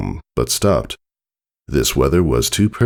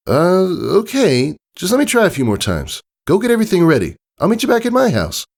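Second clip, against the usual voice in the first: put-on much higher young filly voice; Scootaloo's voice; faster than usual. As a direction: raise the voice high like a young pony.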